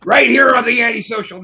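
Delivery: high, loud show plug; announcer shout. Real, not acted. Host plugs the show to the audience, shouting it out. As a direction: call out the show name like a host.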